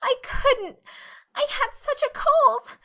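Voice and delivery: put-on very high, loud tearful girl's cry; tearful wail. Anne confessing to Marilla in tears, high wail. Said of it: "Anne dissolved into tears".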